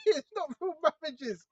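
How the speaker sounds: laugh, then talk through it; amused, chuckling aside. Real, not acted. Preacher on marriages that are not real, laughing.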